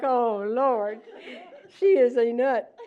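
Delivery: an officer rolling his eyes; imagined officer thinking. Retold or imitated. The officer's imagined thought about her, exasperated. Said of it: "I'm sure he was like".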